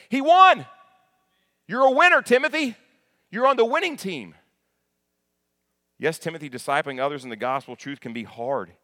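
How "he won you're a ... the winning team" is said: much higher and louder, ringing triumph; triumphant shout. Real, not acted. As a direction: proclaim the win at full voice. Preacher exults over the victory.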